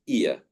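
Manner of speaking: long "ear" as ee-uh; British pronunciation. Shown as a demonstration. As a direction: stretch "ear" into ee-uh with no R. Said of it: "for British pronunciation, they would make it long".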